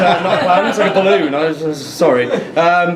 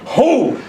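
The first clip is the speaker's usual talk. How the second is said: scared yelp; 6 semitones higher than usual. Retold or imitated. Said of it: "my scared sound".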